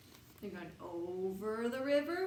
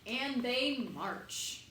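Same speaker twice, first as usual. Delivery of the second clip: slow tired march; 2 semitones higher, much slower than usual. Acted.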